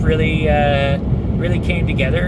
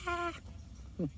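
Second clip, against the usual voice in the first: usual speaking voice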